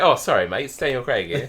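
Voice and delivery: a playful hint of an English accent. Pretending Daniel Craig answers in an English accent, lightly. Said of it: "put on an English accent".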